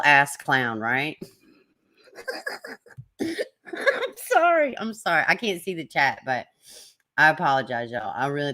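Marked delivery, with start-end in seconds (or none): 3.2-5.2 laughing apology. Real, not acted. Laughing after the joke.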